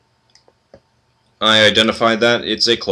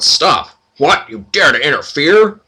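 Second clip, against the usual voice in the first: outraged minotaur; 6 semitones higher, 5 dB louder than usual. Acted.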